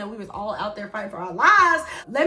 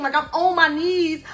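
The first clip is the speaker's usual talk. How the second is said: ordinary delivery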